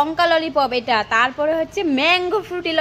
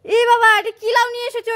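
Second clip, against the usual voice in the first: high pitched disbelief; shocked scolding; 8 semitones higher than usual. Acted. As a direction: exclaim in shocked dismay. Scolding about the small gourd.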